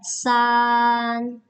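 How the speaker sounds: san held high, level and slow; level slow first tone on san. Shown as a demonstration. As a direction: stretch san flat and high. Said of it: "nada datar ya".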